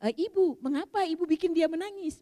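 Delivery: lightly imitated Malaysian accent, pitched high; imitated Malaysian woman. Malaysian pilgrim questions Umi about the crying helper, lightly imitated Malaysian accent. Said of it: "ada ibu-ibu orang Malaysia, dia berkata".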